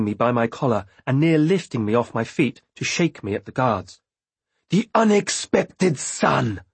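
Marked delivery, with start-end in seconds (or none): 4.7-6.6 high, slow snarl. A narrator's reading. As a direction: snarl it out with contempt. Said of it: "she snarled at them".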